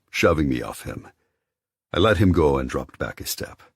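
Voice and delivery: ordinary talk, nothing marked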